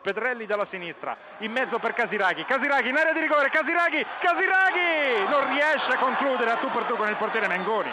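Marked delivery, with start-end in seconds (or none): 2.4-7.9 excited. Real, not acted. Near miss chance commentary.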